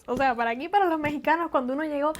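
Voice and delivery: usual speaking voice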